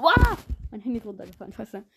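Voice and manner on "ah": sudden high yelp of surprise; real startled cry. Real, not acted. Phone drops mid-recording.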